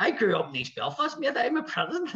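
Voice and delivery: high, protesting broad Belfast voice; Lee, broad Belfast accent. Retold or imitated. Tour man insisting he is Protestant, high broad Belfast accent. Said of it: "he had the broadest Belfast accent".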